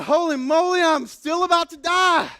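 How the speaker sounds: hammed-up fear. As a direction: cry it out like the end is near.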